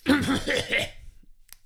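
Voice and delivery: short dry hacking cough. Real, not acted. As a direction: cough from a scratchy throat. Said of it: "Trockener Husten".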